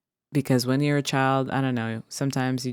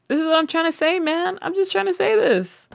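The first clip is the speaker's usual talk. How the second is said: much higher, squeaky ranting voice; playful high-pitched mock rant; mock squeal; 14 semitones higher than usual.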